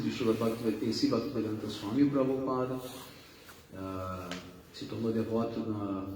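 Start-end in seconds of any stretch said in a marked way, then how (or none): none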